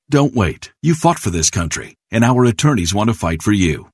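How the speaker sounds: ordinary talk, nothing marked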